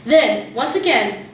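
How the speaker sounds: plain reading voice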